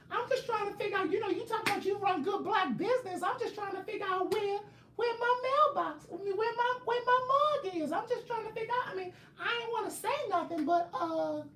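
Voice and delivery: very high squeaky complaining voice; mocking a fan's voice. Mocking Bianca rushing home for the live, squeaky high whine. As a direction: whine like an impatient fan. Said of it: "that's the octave that y'all speak in".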